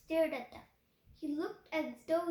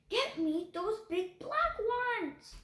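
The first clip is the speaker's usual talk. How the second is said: slow insistent shouted order; shouting; 5 semitones higher, 3 dB louder, slower than usual. A narrator's reading. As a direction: command it, drawn out. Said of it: "the man was shouting".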